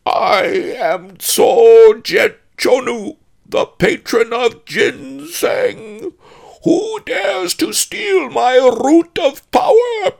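booming, drawn-out, high ghost voice; hollow haunted spectre, Tibetan accent. A narrator's reading. Spectre accusing the thieves of his root, hollow haunted roar. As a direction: intone it slowly and very loud, thick Tibetan accent. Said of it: "haunted voice with a thick Tibetan accent".

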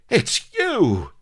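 high, loud cry of recognition; astonished exclamation. Acted. Colonel Blood recognising the man pinning him, astonished cry. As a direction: cry out in disbelief. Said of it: "exclaimed Colonel Blood weakly".

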